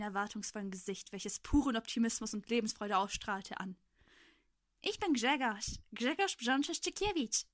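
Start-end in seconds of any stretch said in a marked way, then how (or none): 4.8-7.5 higher, louder proud introduction; smiling boy. A narrator's reading. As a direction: introduce yourself through a smile. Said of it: "sprach er lächelnd".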